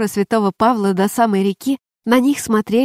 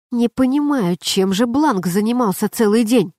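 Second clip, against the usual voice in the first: usual speaking voice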